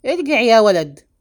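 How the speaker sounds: loud call after someone leaving; commanding shout. A narrator's reading. Father shouts after his fleeing son, loud command. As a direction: shout the order after him. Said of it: "فصاح به سليمان".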